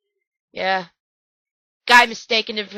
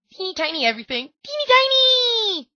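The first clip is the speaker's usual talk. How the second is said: squealing; 11 semitones higher than usual. Real, not acted. Fan squealing at a tiny cosplayer. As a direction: squeal it out, melting at the cuteness.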